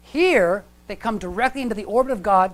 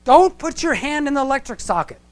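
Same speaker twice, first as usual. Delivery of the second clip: put-on raised warning to a child; parent warning; 7 semitones higher, 7 dB louder than usual. Parent scolding little kid near socket, raised warning. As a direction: call out like a parent to a toddler.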